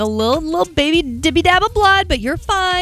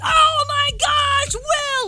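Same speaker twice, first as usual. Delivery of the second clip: put-on high, slow squeal of little kids; kids' excited squeal; 13 semitones higher, much slower than usual. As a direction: gush like thrilled classmates. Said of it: "they were like".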